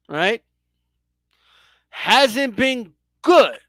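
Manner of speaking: slow, with long pauses between "hasn't been good", "in", "ten", "years"; deliberate, word-by-word needling on "ten years"; drawn-out taunt. Pretend. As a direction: stretch "your son" and "years", leaving big gaps. Host drawing out a taunt.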